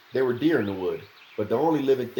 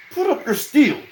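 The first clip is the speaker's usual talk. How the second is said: high, loud stern warning; 8 dB louder than usual. A narrator's reading. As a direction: stop him with a firm command. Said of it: "Dunk warned him at last".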